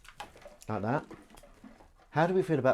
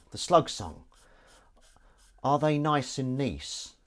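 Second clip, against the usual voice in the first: ordinary talk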